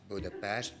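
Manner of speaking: "Budapest" ending in a sh sound, pesht; "Budapest" with s as sh before the t; Hungarian pronunciation. Shown as a demonstration. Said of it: "but not Budapest".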